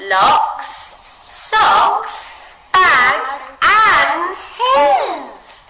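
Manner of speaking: and stretched long, high and loud; listing with a huge drawn-out and; expressive list. Shown as a demonstration. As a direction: draw out and to show the mess. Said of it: "adding expression to the word and".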